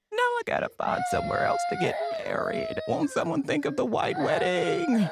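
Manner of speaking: hammed-up sobbing wail, pitched very high with cries breaking into shouts; mock crying. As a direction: wail like a bride who lost her venue, overdone. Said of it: "There were people online crying".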